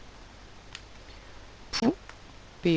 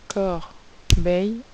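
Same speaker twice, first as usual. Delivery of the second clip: "corbeille" stretched slowly, syllable by syllable; slow dictation; 4 dB louder, much slower than usual. Shown as a demonstration. Teacher slowing "corbeille" so pupils can spell it. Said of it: "Pour écrire corbeille".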